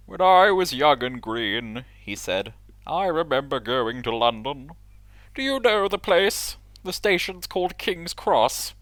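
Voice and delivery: pompous engine voice. Acted. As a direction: boast like a proud old engine. Gordon voiced in story.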